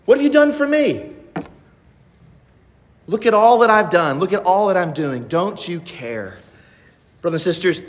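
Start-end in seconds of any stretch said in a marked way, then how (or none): 0.0-6.3 higher, mock-resentful whining; whiny complaint. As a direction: whine it like a sulking servant who feels unthanked. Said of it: "It sounds just like".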